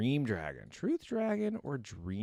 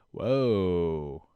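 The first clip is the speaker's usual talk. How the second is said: loud low drawn-out whoa; genuinely taken aback; surprised whoa; 5 semitones lower, 7 dB louder than usual. Real, not acted. Streamer seeing the Sea Dog skin, loud surprised whoa.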